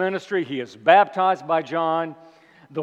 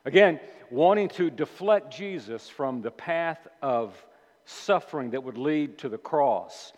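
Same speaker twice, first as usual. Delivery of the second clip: ordinary delivery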